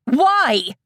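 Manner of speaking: loud, high anguished question; anguished cry. Acted. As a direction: cry out in hurt anger. Eda's anguished demand.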